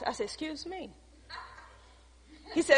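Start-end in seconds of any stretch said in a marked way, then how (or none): 0.2-0.9 barely audible startled reply; a stunned, hushed excuse me; shocked whisper-soft. Retold or imitated. Woman startled by God's order, very soft.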